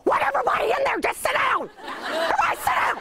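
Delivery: high, laughing mock command to a crowd; half-laughing high mock order; exasperated outburst. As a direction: wave the crowd in your head into silence. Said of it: "you just wanna go".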